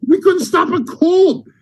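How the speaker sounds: high mock complaint; retold angry rant. Boss griping after a bad game, mock outrage.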